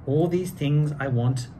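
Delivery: lively storybook read-aloud; reading to children. A narrator's reading. Two hosts reading Seuss to kids, lively storybook voice. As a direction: read it like a bedtime picture book.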